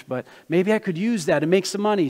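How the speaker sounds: ordinary delivery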